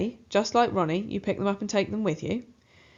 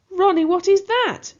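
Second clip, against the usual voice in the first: much higher, loud shocked shout; mother shrieking at the rat in shock; frantic yell; 11 semitones higher, 9 dB louder than usual. A narrator's reading. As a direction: shriek it in panic. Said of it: "she yells frantically".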